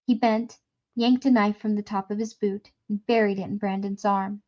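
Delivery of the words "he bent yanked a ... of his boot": ordinary delivery, nothing marked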